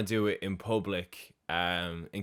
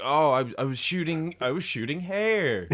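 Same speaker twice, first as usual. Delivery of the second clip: shifty, suspicious; 6 semitones higher than usual. Retold or imitated. Imitating a suspect questioned about his gun, shifty and suspicious. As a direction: imitate a hopelessly unconvincing liar, pitch up. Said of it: "he's so suspicious".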